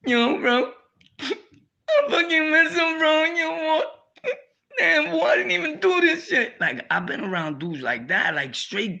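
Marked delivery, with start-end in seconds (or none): 0.1-6.6 high, loud sobbing voice of a grown man breaking down; mock crying. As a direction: wail it out between sobs, voice high and cracking. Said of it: "He like".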